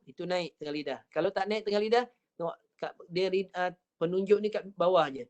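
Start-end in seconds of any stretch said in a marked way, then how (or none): none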